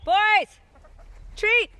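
high, loud call to the animals. Real, not acted. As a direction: holler them over for a treat. Calling the alpacas over.

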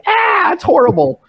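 high-pitched retold cry of horror; appalled outburst. Hearing his bad Spanish mimicked back, high appalled yell. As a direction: yelp it out, appalled at his own sound. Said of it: "I'm like".

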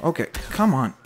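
ordinary delivery

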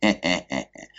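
mocking hehehe chuckle; gleeful cackle. A narrator's reading. Drunk masked man laughing at the police chief, cackling. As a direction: chuckle at the scared officials. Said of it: "захохотал от удовольствия".

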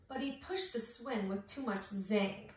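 ordinary delivery, nothing marked

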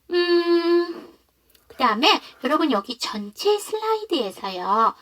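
ordinary talk

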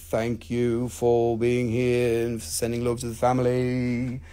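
low, slow, soft drawl of gratitude; deep, unhurried mock-solemn thanks; put-on thanks. Accented thanks to a viewer.

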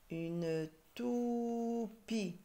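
very slow "toupie", "tou" held high; syllable by syllable. Shown as a demonstration. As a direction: stretch "tou", then separate "pie". Teacher sounding out syllables.

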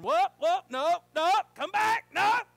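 high, loud calls after a runaway dog; hollering at the dog. Retold or imitated. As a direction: yell it across the yard to stop him. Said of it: "we started hollering".

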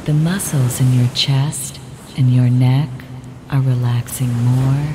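ordinary talk

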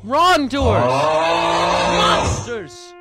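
hammed-up outrage, loud and high, dragged out slowly; mock furious rant. Streamer scolding his chat, hammed-up furious yelling. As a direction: rage at the chat like a wronged preacher.

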